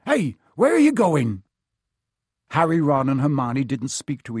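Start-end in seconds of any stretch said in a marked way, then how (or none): 0.1-1.4 higher, louder call after someone leaving; flustered, trying to stop them going; alarmed calling out. A narrator's reading. Hagrid calls after departing children.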